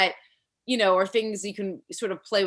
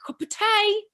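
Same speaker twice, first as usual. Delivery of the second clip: high-pitched mock British accent; 4 dB louder, slower than usual. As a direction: pipe it up as a British caricature. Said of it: "there's a lot of British accents".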